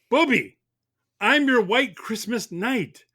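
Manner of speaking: ordinary talk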